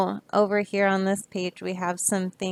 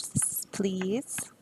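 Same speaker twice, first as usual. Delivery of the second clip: soft aside; 7 dB quieter, slower than usual. Shown as a demonstration. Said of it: "do a sign language".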